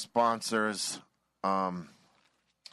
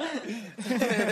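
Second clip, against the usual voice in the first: high laugh; 4 dB louder than usual. Real, not acted. Laughing after the screaming bit.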